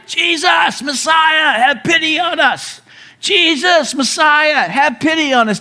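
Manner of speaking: high, pleading shout across a distance; calling out from afar. Retold or imitated. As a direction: cry out to Jesus from far away. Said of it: "they call out".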